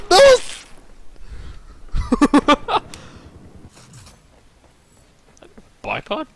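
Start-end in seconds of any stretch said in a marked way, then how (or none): 0.7-4.1 breathy laughter. Real, not acted. Gamer keeps laughing.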